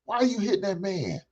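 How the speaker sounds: high, chiding sister stepping in; sister scolding a girl. Pretend. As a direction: play a sister calling a girl out for hitting a man. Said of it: "I don't see none of you sisters saying".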